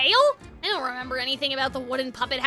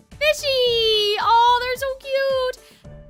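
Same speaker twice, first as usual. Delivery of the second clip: delighted squeal; 7 semitones higher, 5 dB louder, much slower than usual. Acted. Fish float through the air.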